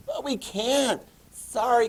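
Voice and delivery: high, sing-song mock-sorry voice; high-pitched fake sympathy; mock apology. Same acted apology to Germany.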